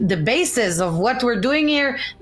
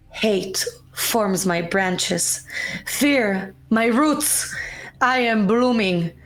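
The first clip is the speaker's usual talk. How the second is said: poem reading; 2 semitones lower, slower than usual. Acted. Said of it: "can I read it for you?".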